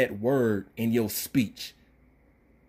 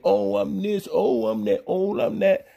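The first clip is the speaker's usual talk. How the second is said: mocking imitation; 7 semitones higher than usual. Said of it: "I listen to your video like".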